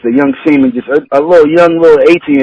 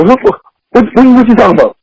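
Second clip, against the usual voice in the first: a higher, startled "what, what, what"; shocked husband; 7 semitones higher than usual. Retold or imitated. Stunned husband asks what his rival means. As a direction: stammer it out caught off guard.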